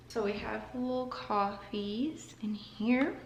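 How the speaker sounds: soft, slowed whisper over the coffees. Real, not acted. Showing her little coffees to viewers, in a slow whisper. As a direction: hush it like sharing a secret.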